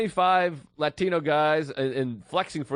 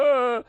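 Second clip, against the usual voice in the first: loud, raised mocking scream; Buffalo Bill; 9 semitones higher, 5 dB louder than usual. Comic as the captor mimicking his victim, mocking scream. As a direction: ape the victim's scream right back. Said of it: "And he's going".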